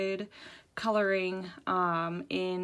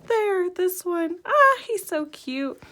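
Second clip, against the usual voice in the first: high-pitched, delighted gushing; excited high voice; 10 semitones higher, 6 dB louder than usual. Real, not acted. Vlogger finding the Cheshire Cat page, gushing excitedly. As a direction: coo loudly and high over a cute find. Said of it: "my voice goes up a couple octaves".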